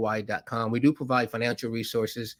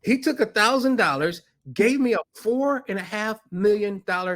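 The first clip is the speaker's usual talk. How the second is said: excited; 8 semitones higher than usual. Real, not acted. Climax of deal story.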